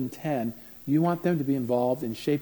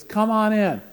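calling out; 9 semitones higher, 6 dB louder, slower than usual. Retold or imitated. Mother calling son in.

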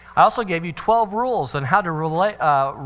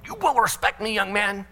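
mock stern father, pitched up; demanding parent; 5 semitones higher than usual. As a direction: lay down the law like an overbearing dad. Parent demanding respect, bad example.

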